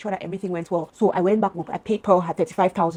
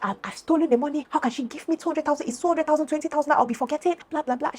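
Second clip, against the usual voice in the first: high, mock-outraged retelling; imitated screaming rant; 8 semitones higher than usual. Friend accusing her before the children, high imitated rant. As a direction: mimic her rant high-pitched, without shouting. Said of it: "she was screaming and calling me thief".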